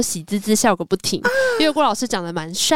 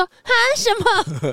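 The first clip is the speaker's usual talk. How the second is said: very high, puzzled what; high squeaky huh, what; surprised question; 12 semitones higher than usual. Retold or imitated. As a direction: ask it like a startled little boy. Boy asks teacher's nickname.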